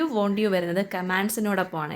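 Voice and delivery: ordinary talk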